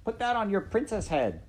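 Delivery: high, coarse servant voice; shrill servant woman. Acted. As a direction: bark it like a crude maid. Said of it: "said Midge".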